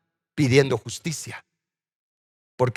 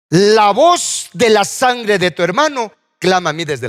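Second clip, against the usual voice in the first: loud, slow, higher-pitched proclamation; crying out; 5 semitones higher, 5 dB louder, slower than usual. Acted. God telling Cain of the blood, loud proclamation. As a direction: declaim it forcefully, drawing out the first words. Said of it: "En hebreo significa hablar fuerte".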